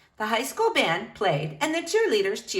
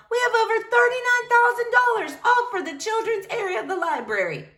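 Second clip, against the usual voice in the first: much higher, joyful cry; excited yell; 13 semitones higher than usual. Acted. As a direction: shout the victory. Crowd member announces money.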